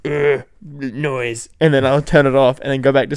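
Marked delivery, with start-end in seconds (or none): 0.1-1.5 caveman grunt. Retold or imitated. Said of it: "caveman setting in my head".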